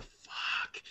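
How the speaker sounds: a breathless unvoiced whisper; whispered curse. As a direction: hiss it under your breath, dreading being caught. Caught by officer, private dread.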